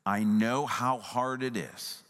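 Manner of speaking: low, tender reassurance with long pauses; empathetic. Shown as a demonstration. Preacher as Jesus comforting the anxious, empathetic. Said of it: "the empathetic tone".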